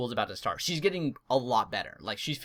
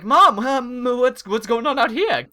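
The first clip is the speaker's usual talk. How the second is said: loud, high call to mom; alarmed call; 9 semitones higher, 7 dB louder, slower than usual. Retold or imitated. Calling out to mom about the smell.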